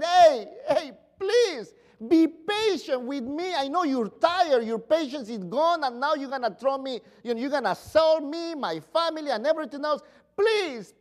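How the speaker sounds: high, loud, begging servant; desperate pleading. Acted. Servant begging the master for mercy, high and loud. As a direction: plead for your family as if on your knees. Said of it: "He was saying".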